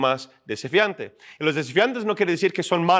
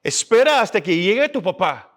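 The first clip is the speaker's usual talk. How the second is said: imitating his mother, higher pitched and stern; scolding mom; 6 semitones higher, 3 dB louder than usual. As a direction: play the fed-up mom ordering the boys to stop. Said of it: "Y mi mamá llega y dice".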